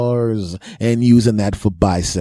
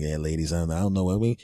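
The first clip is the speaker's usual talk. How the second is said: suave quiet; 5 semitones lower, 8 dB quieter, faster than usual. Retold or imitated. Suave low voice to ladies.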